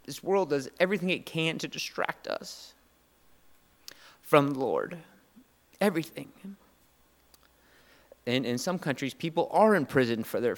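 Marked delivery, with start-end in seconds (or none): 0.1-6.3 emotional. Real, not acted. Pastor moved thinking of his boys, emotional. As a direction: voice lifting with held-back emotion. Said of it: "Sorry, I'm emotional".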